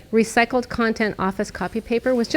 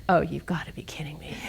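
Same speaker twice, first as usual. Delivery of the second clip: whispered, lower, disbelieving; 4 semitones lower, 6 dB quieter than usual. Retold or imitated. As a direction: breathe it out in mock disbelief. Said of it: "And I'm like".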